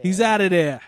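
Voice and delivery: loud, high Boston accent. Shown as a demonstration. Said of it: "it's my best Boston accent".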